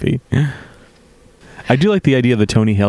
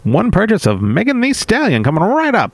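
chipper assistant voice; 10 semitones higher than usual. Pretend. Playing the earbud assistant.

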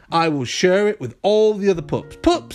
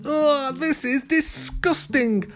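high, drawn-out groan of disgust; 12 semitones higher, slower than usual. A narrator's reading. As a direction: wail it in slow revulsion. Pup fell into mud puddle.

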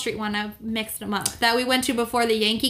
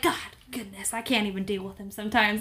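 exasperated mutter; 3 semitones lower, 5 dB quieter than usual. Retold or imitated. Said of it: "she was like".

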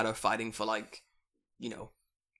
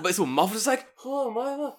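ordinary talk

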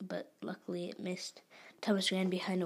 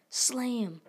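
raised, punchy impact word; loud exclamation; 4 semitones higher, 6 dB louder than usual. A narrator's reading. Narrator voices Peter tackling Thomas, loud raised exclamation. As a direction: hit it like a sudden collision. Tackle sound acted in story.

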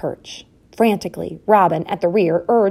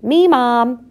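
put-on high, loud little child; little piping voice; 6 semitones higher, 6 dB louder than usual. A narrator's reading. Smallest mouse speaks up at roll call, piping. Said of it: "piped a little voice".

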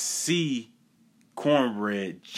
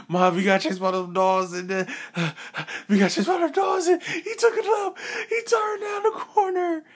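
imitated high-pitched, gasping wail; out of breath, near crying. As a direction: blurt it to mom between panicked gasps. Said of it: "I'm just crying. Not literally crying, but I'm out of breath".

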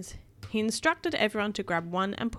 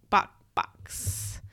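mock chicken cluck; 13 semitones lower than usual. Man in chicken suit clucks.

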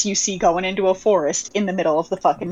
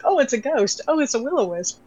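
higher, rising alarm; panicked inner voice; 6 semitones higher than usual. Retold or imitated. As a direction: act out your own rising panic. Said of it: "My brain always went".

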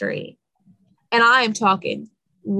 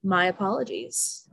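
normal talking voice

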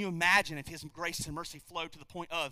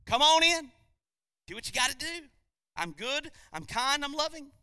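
mock winking God; 7 semitones higher, 4 dB louder than usual. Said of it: "and say".

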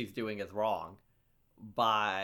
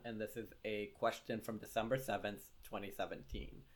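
ordinary delivery

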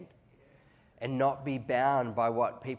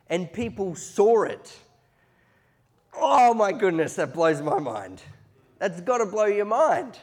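high, loud, fervent preacher voice; impassioned preaching; 10 semitones higher, 9 dB louder than usual. Real, not acted. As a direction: preach it at full force, high and amazed, stretching key phrases. Preaches at full force.

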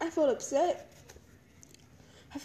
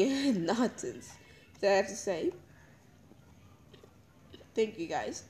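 ordinary talk

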